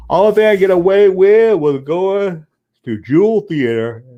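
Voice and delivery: words stretched and slow through a yawn; talking through a yawn. Real, not acted. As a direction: yawn your way through the sentence. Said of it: "Oh, I'm yawning".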